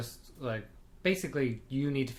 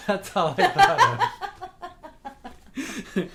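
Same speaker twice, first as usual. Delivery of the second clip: laughing; 7 dB louder than usual. Real, not acted. Recalling Fawlty Towers, laughing hard.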